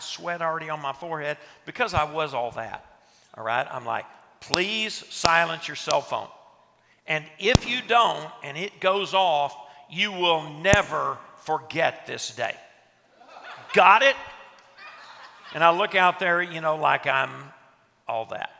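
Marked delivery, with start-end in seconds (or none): 4.4-14.2 slow, loud, heavily emphatic warning; intense warning. Retold or imitated. Self-important speaker warning his group about cell phones, intense and loud. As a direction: lay down the law with mock self-importance. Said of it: "with my neck veins bulging".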